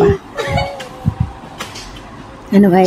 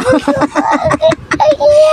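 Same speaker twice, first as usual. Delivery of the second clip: high screaming laugh; shrieking laugh. Real, not acted. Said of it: "Tawa pa". (sigaw na tawa)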